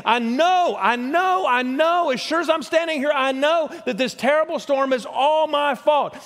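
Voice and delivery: Jonah confessing. Acted. Jonah telling sailors the storm is his fault, mildly voiced. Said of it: "Jonah voiced confessing".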